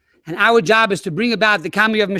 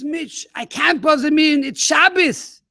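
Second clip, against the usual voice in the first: wife objecting; 7 semitones higher, slower than usual. Retold or imitated. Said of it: "She says".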